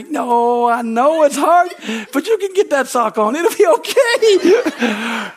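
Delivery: high, slow, sugary coaxing voice with a laugh; syrupy sweet coaxing. Retold or imitated. God sweet-talking a gentle believer, high and cooing. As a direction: coo it like soothing a toddler over a sock. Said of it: "he's never talked to me sweet".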